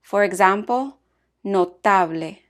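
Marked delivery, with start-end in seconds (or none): 1.4-2.4 "notable" said in Spanish as "no-TA-ble", stress on "ta"; Spanish pronunciation. Shown as a demonstration. Teacher models a Spanish -ble word for learners, Spanish pronunciation. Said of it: "stressed on the next to the last syllable".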